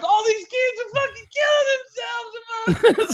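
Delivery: high, laughing crying shriek; panicky Tucker. Retold or imitated. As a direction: wail it in baffled panic. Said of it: "that's when Tucker's like".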